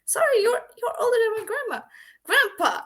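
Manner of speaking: high and loud, with laughter; laughing no-way refusal; incredulous. Retold or imitated. She refuses the old man.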